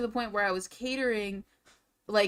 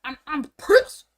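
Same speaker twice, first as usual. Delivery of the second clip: high, loud, slowed I'm pissed; real heated outburst; 6 semitones higher, 11 dB louder than usual. Real, not acted. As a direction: blurt the anger out. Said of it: "laying in".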